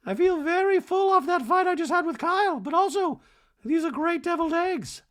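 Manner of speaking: very high, loud, squeaky sing-song voice; hammed-up high Dorit voice; mock Dorit. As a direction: gush it like a ditzy diva. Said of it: "Dorit's like".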